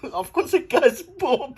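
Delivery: high, slow mocking voice of the guy; mock high quote. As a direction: pitch up and drag it out teasingly. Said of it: "He goes".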